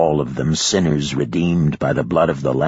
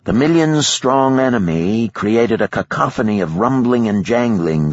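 ordinary delivery, nothing marked

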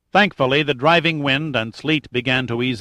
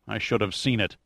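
softer, self-reproaching murmur; bitter murmur; 3 semitones lower, 7 dB quieter than usual. A narrator's reading. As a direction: mutter it bitterly to yourself. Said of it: "Pitt murmured bitterly".

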